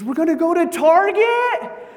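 very high, loud squeal of delight; mock losing her mind. Wife guessing a trip to Target, hammed-up ecstatic squeal. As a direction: shriek it like an overjoyed wife. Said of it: "Loses her mind".

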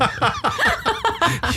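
a sudden high squeal of laughter. Real, not acted. Cracking up at his own joke, high squealing laugh. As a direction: burst into a squeaky cackle.

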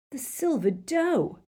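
high, drawn-out excited realisation; high-pitched thrilled gasp of recognition. A narrator's reading. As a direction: blurt it in amazed excitement. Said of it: "he said excitedly".

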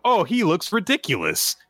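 high-pitched imagined onlooker's jeer; mock derision. Imagined onlooker mocking his looks.

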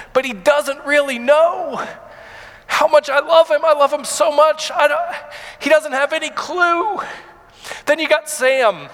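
pushing through rising emotion; real tears close, voice lifting; choked up. Real, not acted. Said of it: "I'm gonna get emotional".

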